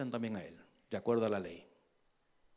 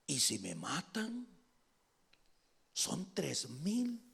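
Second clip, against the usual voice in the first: hushed mock-fearful question; timid worry; 11 dB quieter, slower than usual. David objecting to God's order, timid worry. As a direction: softly voice a nervous objection. Imagined worried reply to God.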